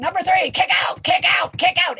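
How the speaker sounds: loud high urgent calls; radio instructions yelled. Retold or imitated. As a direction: bark orders over the radio. Radio guy yelling instructions.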